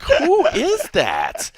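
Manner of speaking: very high, slow drawn-out who; a high, slow, stunned who is that; surprised. Retold or imitated. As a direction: stretch it out in amazement. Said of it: "I'm like".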